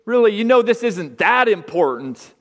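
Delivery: a loud, high tempter coaxing them to relax; dismissive tempter voice. Acted. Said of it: "or maybe".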